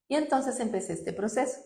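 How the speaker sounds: normal talking voice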